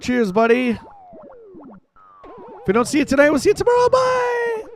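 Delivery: high sign-off voice. Acted. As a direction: wave them off like a cheery announcer. Mock farewell to chat.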